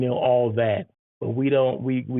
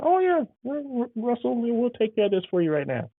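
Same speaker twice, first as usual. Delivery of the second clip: much higher, sweet helpful clerk voice; high chirpy front desk service voice; eager desk clerk; 11 semitones higher than usual. Retold or imitated. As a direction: play an overly accommodating hotel clerk. Front desk clerk answering direct guest.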